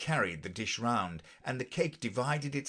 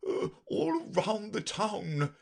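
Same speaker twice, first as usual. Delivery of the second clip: high, slow nervous quiver; 13 semitones higher, slower than usual. A narrator's reading. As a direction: let the voice quiver with nerves. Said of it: "his voice quite quivered".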